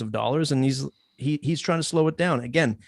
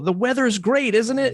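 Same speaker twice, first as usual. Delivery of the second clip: sugary small talk, much higher; fake friendly; 11 semitones higher than usual. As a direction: chirp it with a sweet fake smile. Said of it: "you smile".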